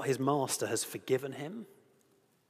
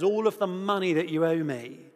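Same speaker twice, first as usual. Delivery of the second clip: angry demand; 3 semitones higher, 7 dB louder than usual. Acted. Servant choking his fellow servant.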